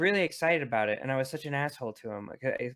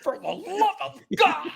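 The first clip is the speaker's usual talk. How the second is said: much higher, slow exasperated cry; mock outburst; 14 semitones higher, 4 dB louder, slower than usual. Joining the mock yelling.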